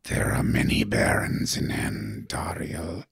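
low unvoiced skeletal rasp; creepy whisper. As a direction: whisper like a crucified corpse. Skeleton signpost speaks.

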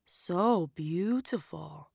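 soft, slow admiration; a hushed, lingering compliment; admiring. A narrator's reading. Old woman admiring the girl's skin, soft and slow. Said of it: "the woman admired".

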